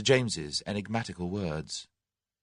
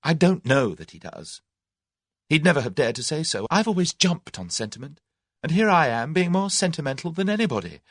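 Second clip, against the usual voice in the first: higher-pitched, emphatic self-argument; argumentative; 8 semitones higher, 4 dB louder than usual. A narrator's reading. Tuppence disputing with herself over Tommy's feelings, argumentative. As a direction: argue it out with an invisible opponent. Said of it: "as though arguing with an unseen opponent".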